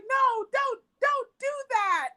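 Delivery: high, whiny mock protest, slow at the end. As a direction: play the hurt man begging her to stop. Paul pleading, voiced in retelling.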